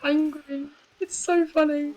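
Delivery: cracking voice. Real, not acted. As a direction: voice breaking while amused. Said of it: "The voice cracks are horrible".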